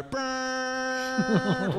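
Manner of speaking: high, held horn blast; nasal horn honk. Acted.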